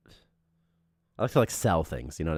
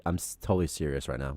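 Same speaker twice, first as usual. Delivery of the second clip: lower, softer, earnest insistence; dead serious; 5 semitones lower, 5 dB quieter than usual. Acted. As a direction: insist that you truly mean it. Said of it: "I'm dead serious".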